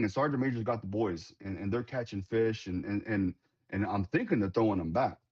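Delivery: ordinary talk, nothing marked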